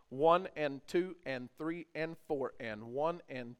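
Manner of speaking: slow counting of eighth notes; counting out rhythm. Shown as a demonstration. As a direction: count one and two and slowly. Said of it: "So we're actually going like this".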